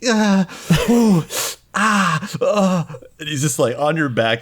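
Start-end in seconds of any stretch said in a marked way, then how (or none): 0.1-2.8 high shaky pained moans; shivering whimpers. Acted. Rory in shock whimpering on Tina's back.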